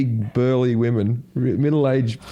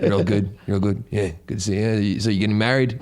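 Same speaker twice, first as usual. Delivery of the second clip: low, casual crowd-work mumble; imitating a laid-back comedian; cocky, stoned comic; 6 semitones lower, faster than usual. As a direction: chat idly to the front row. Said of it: "I think he was a bit stoned".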